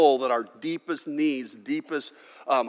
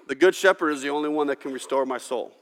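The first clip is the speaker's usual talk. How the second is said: normal talking voice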